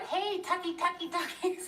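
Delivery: high sing-song calling out; calling the dog. Retold or imitated. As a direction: call the dog home across the yard. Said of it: "When we're screaming outside".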